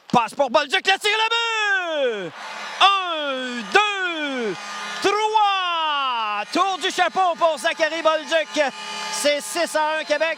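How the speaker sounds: loud counting of the hat trick; excited sports commentary. Real, not acted. Hat-trick goal call, counting goals. (commentaire sportif exalté)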